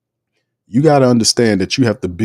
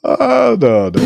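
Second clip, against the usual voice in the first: real laughter; 9 semitones higher, slower than usual. Real, not acted. Laughs at quoted firing threat.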